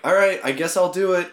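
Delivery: high and loud, grudgingly giving in; reluctant giving in. Retold or imitated. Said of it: "but I'm like".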